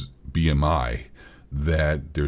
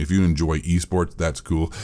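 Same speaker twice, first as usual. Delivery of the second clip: usual speaking voice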